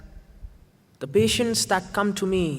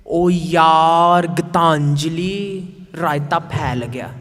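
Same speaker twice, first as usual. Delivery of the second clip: put-on loud, exclaimed in a Punjabi New Delhi accent, Gitanjali drawn out; the friend's Punjabi Delhi lament, loud; 7 dB louder, much slower than usual. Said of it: "in a very Punjabi New Delhi accent".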